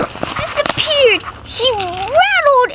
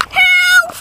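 panicked calls for help; 6 semitones higher, much slower than usual. Acted. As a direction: call out as he slides helplessly. Character can't stop, calls out.

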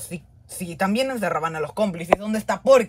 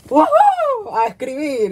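very high, loud whoop; squealing, high-pitched glee; gleeful cheer; 8 semitones higher, 5 dB louder, slower than usual. Acted. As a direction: whoop and dash off to write. Delighted to start writing.

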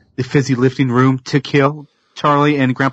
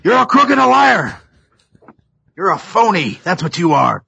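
angry yelling; 8 semitones higher than usual. Retold or imitated. Grandfather yelling at the candy maker, furious high shout. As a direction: rage at him like a betrayed old man. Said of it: "starts yelling at Willy Wonka".

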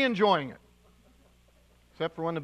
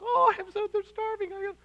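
high, gushing mock sympathy; mock emotional giver; 12 semitones higher than usual. Preacher mocking a gushing giver with high sympathy. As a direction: parody a tearful impulse giver.

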